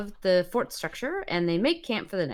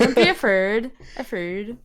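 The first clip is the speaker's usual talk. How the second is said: put-on higher, broad rural accent; pig farmer's dialect; 4 semitones higher than usual. Pig farmer reassuring visitors, broad country accent. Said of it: "He's great".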